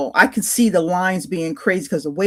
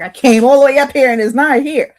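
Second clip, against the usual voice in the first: higher and louder, fed-up complaint; annoyed outburst; 6 semitones higher, 7 dB louder than usual. Retold or imitated. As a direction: let the frustration burst out. Annoyed at missing magazine.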